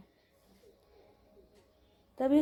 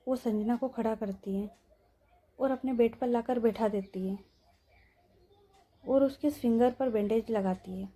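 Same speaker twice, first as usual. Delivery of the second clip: ordinary delivery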